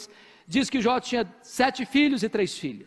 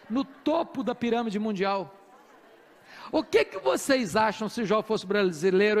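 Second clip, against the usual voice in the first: ordinary delivery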